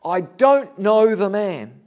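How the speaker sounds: loud, slow, emphatic denial; denial with an oath. Retold or imitated. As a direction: swear it loudly, drawing out the words. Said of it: "he denied it with an oath".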